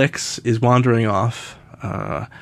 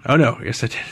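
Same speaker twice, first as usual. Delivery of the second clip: ordinary talk, nothing marked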